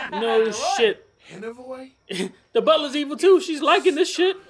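high-pitched talk through laughter; laughing to tears. Real, not acted. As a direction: crack up while talking, voice climbing. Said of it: "I'm crying again".

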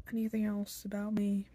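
ordinary delivery

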